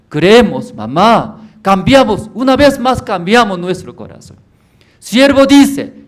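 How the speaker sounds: high, loud, earnest pleading; urgent pleading. Acted. Son pleading with his mother to believe, high and loud. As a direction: plead with her to trust God.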